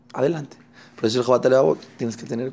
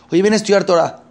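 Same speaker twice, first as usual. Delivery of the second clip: higher, louder friendly call; mild hammed-up invitation; inviting call; 6 semitones higher, 5 dB louder than usual.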